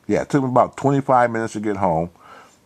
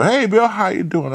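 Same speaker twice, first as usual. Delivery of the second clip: Martha's greeting, higher; 12 semitones higher than usual. Retold or imitated. Martha voiced in retold story.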